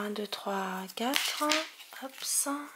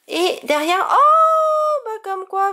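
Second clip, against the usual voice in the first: much higher and louder, a burst of joy; thrilled surprise; 11 semitones higher, 9 dB louder, slower than usual. Real, not acted. Collector thrilled by a surprise last card, shouting with joy. Big pull at pack's end.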